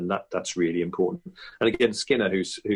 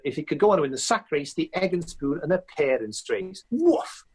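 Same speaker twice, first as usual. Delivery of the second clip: higher, excitable athletics commentator voice; sports commentator voice talking up the sack race; Colin Jackson impression; 8 semitones higher than usual. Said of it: "one night I just said".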